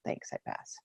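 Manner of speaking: whispered. Real, not acted. Closing share, voice drops away.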